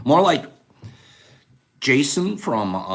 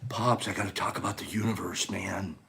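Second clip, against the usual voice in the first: low and much softer, dead serious; hushed earnest plea; 10 semitones lower, 9 dB quieter than usual. Retold or imitated. Joe begging Pops to talk about the universe, low and hushed. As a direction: confide it like a late-night revelation.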